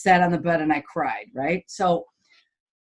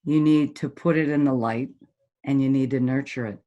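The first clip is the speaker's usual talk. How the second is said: normal talking voice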